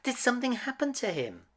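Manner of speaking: quick anxious question; anxious Belle. A narrator's reading. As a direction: rush it out in worry. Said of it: "she said to the horse".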